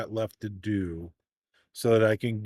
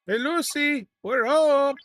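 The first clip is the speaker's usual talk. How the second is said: hammed-up, higher, louder "Hey, Lucy, we're home"; a playful sing-song sitcom homecoming call, pitched way up; sitcom husband arriving home; 6 dB louder than usual. Gamer jokes to his crew in a mock sitcom homecoming call.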